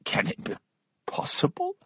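whispered astonishment; hushed, incredulous gasp of words; stunned disbelief. A narrator's reading. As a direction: breathe the question out in shock. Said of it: "he cried".

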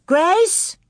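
high, rising call. A narrator's reading. Carrie running to find little Grace, calling out high. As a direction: call the child's name, pitch lifting.

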